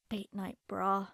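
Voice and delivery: low, slow, quiet grumble. A narrator's reading. Narrator poking at the unwanted underwear, grumbling. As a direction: grumble it to yourself under your breath. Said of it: "I grumble as I poke".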